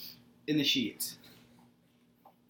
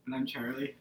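soft, hushed self-introduction; quiet at night; 5 semitones lower, 9 dB quieter than usual. Real, not acted. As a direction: keep it down so no one wakes. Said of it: "Why don't you speak up there".

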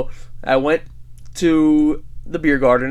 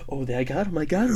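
softer, sneaky gloating villain; Randall; 7 dB quieter than usual. Retold or imitated. Creepy accuser peeking at the man he got caught, sneaky. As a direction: imitate a creep hiding behind a wall. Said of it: "like fucking Randall from Monsters, Inc".

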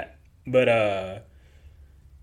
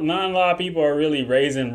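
normal talking voice

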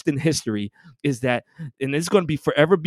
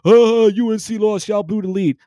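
mocking Duke fans; 6 semitones higher, 4 dB louder than usual. Tar Heel fan mimicking taunting Duke fans, high mocking sneer. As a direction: mimic gloating rivals. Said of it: "wanna come out from the damn freaking ground".

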